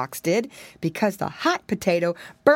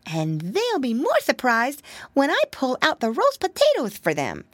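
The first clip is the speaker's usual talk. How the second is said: eager piglet; 6 semitones higher than usual. Acted. Piggy boy character voice.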